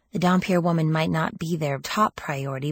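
plain reading voice

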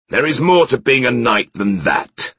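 sharp, loud and high retort; snapping. A narrator's reading. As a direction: snap it back hotly. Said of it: "snapped Eladan".

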